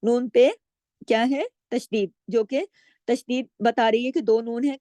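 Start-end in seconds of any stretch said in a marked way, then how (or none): none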